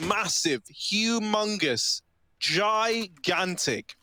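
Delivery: slow, stretched emphasis on each word; drawn-out hype words. Real, not acted. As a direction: savour every word slowly. Stacking words for a huge round.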